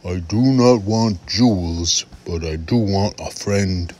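measured creature voice, words pressed out; scratchy frog. Acted. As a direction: bargain earnestly as a lonely frog. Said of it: "the frog said".

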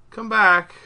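playful plea called out; calling after. Pretend. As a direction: call out for it to return. Calling after what was taken.

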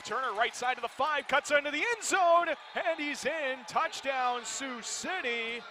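rising, building excited shout; touchdown call. Real, not acted. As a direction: call the score as it happens. Play-by-play touchdown run.

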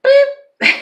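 high, loud mock buzzer; mock buzzer beep. Imitating a game-show buzzer to reject the idea. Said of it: "This is a no".

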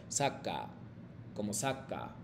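"saca" suave y grave, sin acento marcado; pronunciación real. Shown as a demonstration. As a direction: di "saca" sin separar las sílabas. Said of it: "Realmente nosotros cuando pronunciamos esta palabra". (modelo suave de "saca", sin énfasis)

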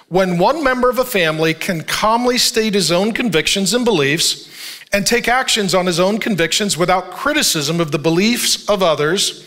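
ordinary talk

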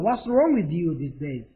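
slowly drawn-out model question; slow model read. Shown as a demonstration. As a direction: spell it out slowly for the class. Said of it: "이렇게, 이렇게".

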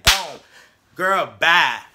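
put-on triumphant, loud "girl, bye"; loud sassy sendoff. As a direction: punch "bye" out loud with attitude. Speaker's own cheer at Andy.

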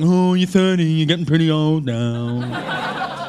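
slow, dopey mocking voice; mumbled mock taunt; mocking friends. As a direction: play the friends as slurring idiots. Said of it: "my friends with massive speech impediments".

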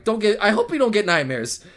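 ordinary talk, nothing marked

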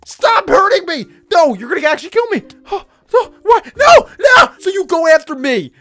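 high, loud yelling under attack; frantic gamer yelling at attackers; panicked protest. Real, not acted. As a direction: shout in outraged alarm. Being attacked in game.

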